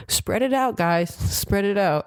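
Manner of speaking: high called-out coaching instruction, slowing on the repeat; imitating a coach calling from the bench. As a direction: call it across the court to the kids. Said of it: "he kept going".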